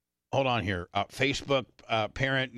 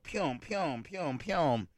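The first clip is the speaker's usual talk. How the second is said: soft, higher "pyom" sound effect, repeated; imitated needle jabs; 4 semitones higher, 6 dB quieter, much slower than usual. Patient imitating his own needle jabs, soft playful sound effects. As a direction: make four light jabbing noises.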